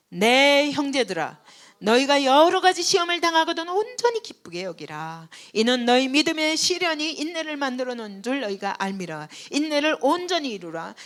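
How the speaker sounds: booming scripture read with the congregation; loud congregational reading. Acted. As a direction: lead the room in reading aloud.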